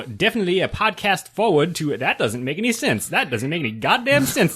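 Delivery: put-on lilting, bouncy Cajun accent. Host stumbles over the review's wording, staying in Cajun accent. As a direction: grumble at the text in a Cajun accent. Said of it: "get into the accent".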